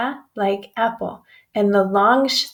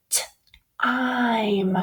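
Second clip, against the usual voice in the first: whispered sound demo; 3 dB quieter than usual. Shown as a demonstration. Teacher sounding out time for the class, in a whisper. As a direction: whisper it slowly, T then I'm, sounding out time. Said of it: "Listen to this sound".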